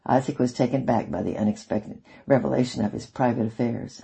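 usual speaking voice

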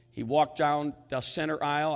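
ordinary talk

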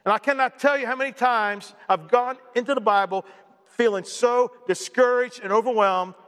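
ordinary talk, nothing marked

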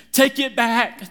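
high, loud frantic shout of take it back. Retold or imitated. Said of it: "And I'm going".

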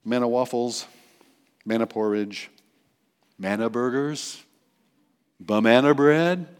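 ordinary talk

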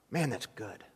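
chewing while talking; mouth full. Real, not acted. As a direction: talk through food. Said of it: "eating while I'm up here".

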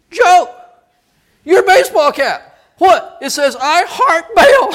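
loud, high, mock-alarmed whisper-shout; comic friend voice. Frank warns Joe about his cap, loud mock alarm. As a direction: point out the cap in mock panic. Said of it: "Frank looks over at Joe and goes".

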